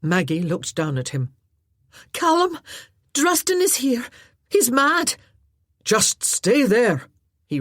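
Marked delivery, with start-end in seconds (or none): 2.1-5.2 high, loud call down; frightened call. Acted. Maggie calling down to Callum about Druston, high frightened warning. 5.8-7.1 slow, louder firm command; firm order. Acted. Callum ordering Maggie to stay put, slow firm call.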